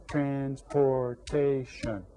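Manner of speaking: half-speed, evenly spaced syllables; syllables clapped out. Shown as a demonstration. Teacher leads class clapping out the word, syllable by syllable. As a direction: draw out each syllable of "Transportation" evenly. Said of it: "Clap it out".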